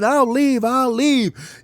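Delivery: high, hammed-up surrender; mock-terrified storybook squeal; panicked dragon. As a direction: plead as a defeated dragon. Beaten dragon begs.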